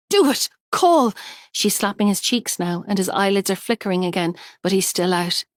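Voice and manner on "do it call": loud, high frantic command; panicked shout at her friend; urgent order. A narrator's reading. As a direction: bark the order. Urging friend to call ambulance.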